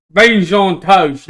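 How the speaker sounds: put-on slow, light British accent; slow British catchphrase; British goblin accent. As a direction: drawl it out, British style. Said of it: "kinda talk like this all the time".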